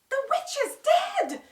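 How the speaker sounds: very high, loud joyful shout; Dorothy shouting to the lion. A narrator's reading. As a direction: shout the news out. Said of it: "she shouted".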